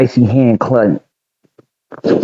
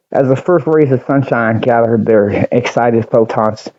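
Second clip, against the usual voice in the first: plain reading voice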